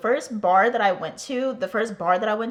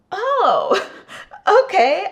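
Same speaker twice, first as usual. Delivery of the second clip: surprised oh okay; 12 semitones higher, 7 dB louder, much slower than usual. Retold or imitated. Startled relief finding the bars.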